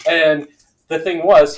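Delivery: ordinary talk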